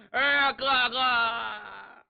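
very slow drawn-out cry of hello; wailing reunion with her brother; sister's drawn-out tearful cry. A narrator's reading. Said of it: "把大嘴一咧就哭了".

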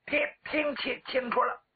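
high stuttering reply; stammer. Acted. Young warrior answers the commander, stammering. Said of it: "人送外号小磕".

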